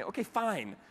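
a hint of a fed-up mother; annoyed mom. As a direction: mom grudgingly agreeing to read aloud. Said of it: "flustered and a little annoyed with me".

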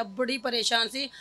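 ordinary talk, nothing marked

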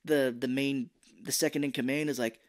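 normal talking voice

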